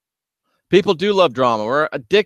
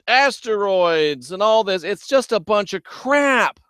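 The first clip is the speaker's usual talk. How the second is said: worked-up rant; 7 semitones higher than usual. Real, not acted. Ranting about rapture predictions.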